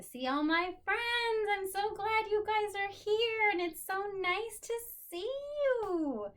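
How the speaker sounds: teacher beaming at her class; delighted greeting. Real, not acted. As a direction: gush over seeing your friends.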